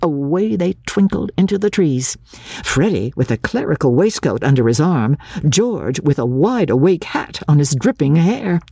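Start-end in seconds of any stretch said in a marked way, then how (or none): none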